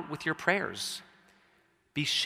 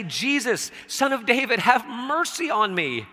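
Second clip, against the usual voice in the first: raised, higher-pitched plea read from scripture; louder, high cry to be heard over a crowd; shouting out; 9 semitones higher, 6 dB louder than usual. A narrator's reading. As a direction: call out loudly for mercy. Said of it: "he began to shout out".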